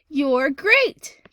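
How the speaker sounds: loud, bright exclamation; enthusiastic. Shown as a demonstration. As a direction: praise them with gusto. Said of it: "We're saying it with enthusiasm".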